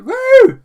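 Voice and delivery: high, loud woo; piercing mock cheer; celebratory whoop. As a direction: let out a big hammed-up whoop.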